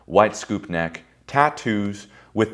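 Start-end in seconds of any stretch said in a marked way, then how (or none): none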